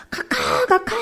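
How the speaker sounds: high calling-out cry of a name. Retold or imitated. Child in a dream calling out to the president, high call.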